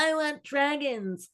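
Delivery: high, slow mock whine; drawn-out high voice of a disappointed viewer; complaining viewers. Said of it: "I think people are like".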